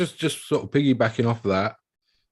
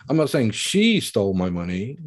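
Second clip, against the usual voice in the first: strong, raised emphasis on "she"; stress on SHE; 2 semitones higher, 5 dB louder than usual. Shown as a demonstration. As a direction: hit "she" to point at someone else. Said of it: "Someone else stole it".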